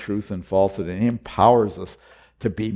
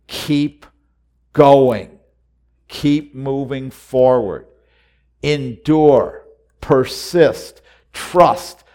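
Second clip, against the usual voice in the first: louder, higher, urging each word; rising exhortation; 4 semitones higher, 7 dB louder, much slower than usual. Real, not acted. Preacher urging the congregation to persist, louder. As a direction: drive the charge home to them. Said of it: "preacher exhorting congregation".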